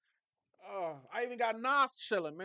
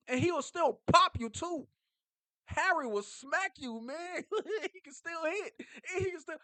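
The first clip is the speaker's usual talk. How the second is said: hyped up; 10 semitones higher, 6 dB louder than usual. Real, not acted. Hyping Harry's hitting, laughing.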